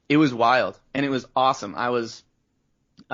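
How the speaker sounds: normal talking voice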